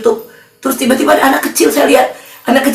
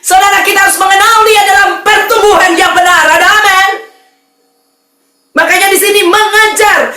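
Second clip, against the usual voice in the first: raised, driving sermon delivery; fiery preaching; 5 semitones higher than usual. Acted. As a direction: preach it hard to the congregation. Preaching at full force. (berkhotbah dengan semangat berapi-api)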